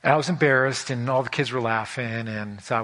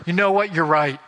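higher and louder, a hammed-up contrite surrender; sarcastic mock apology; 7 semitones higher, 6 dB louder than usual. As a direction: overplay the sweet giving-in nobody ever says. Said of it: "The other person goes".

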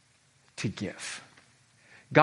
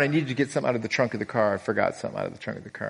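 fast, offhand dad voice; dad's quick request; 3 semitones lower, 3 dB quieter, much faster than usual. Retold or imitated. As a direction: rattle it off fast like it's nothing. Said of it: "So my dad goes".

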